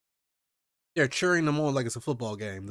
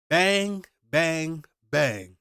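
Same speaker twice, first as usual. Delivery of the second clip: mock gunshots; 4 semitones higher, 5 dB louder, much slower than usual. Imitating gunshots.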